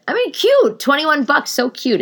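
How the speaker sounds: high, sing-song sarcastic praise; mock gushing. Real, not acted. Telling viewers the pricey candle is cute, high mock gush. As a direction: gush with a wink of irony.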